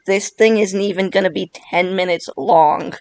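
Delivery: usual speaking voice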